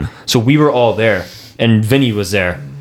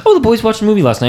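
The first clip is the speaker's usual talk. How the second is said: much higher, lightly voiced mother; imitated mom; 11 semitones higher than usual. Mom coming downstairs next day, high curious musing. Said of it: "So my mom comes down. She goes".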